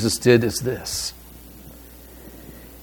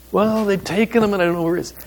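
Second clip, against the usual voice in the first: high, pleading, mildly distraught; upset Mary; 8 semitones higher, faster than usual. Retold or imitated. Mary tells the gardener they took him, mildly upset and high. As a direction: voice a mild touch of Mary's distress, up high.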